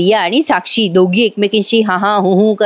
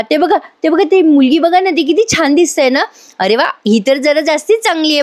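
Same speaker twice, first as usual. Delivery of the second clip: higher, mock-eager pointing out girls; wife sarcastically taunting her husband, voiced higher; sarcastic jibe; 6 semitones higher than usual. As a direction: needle him with fake delight. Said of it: "Sayali चिडते".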